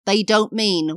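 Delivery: ordinary talk, nothing marked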